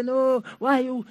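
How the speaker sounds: put-on wail of a guilt-tripping latecomer; mock crying. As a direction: imitate a tearful protest, dragging it out slowly. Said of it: "She will cry sometimes and blackmail them".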